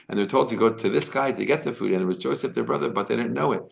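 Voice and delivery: ordinary talk, nothing marked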